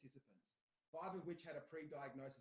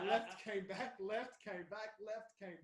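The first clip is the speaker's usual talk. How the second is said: laughing; 6 semitones higher, 11 dB louder, slower than usual. Real, not acted. Laughing about quitting three times.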